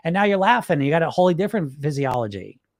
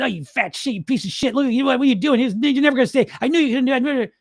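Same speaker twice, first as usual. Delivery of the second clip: higher, sneering voice tearing yourself down; mock the fat shit with contempt; a sneering inner bully, higher and scornful; nasty inner critic; 7 semitones higher than usual. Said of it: "the one voice says".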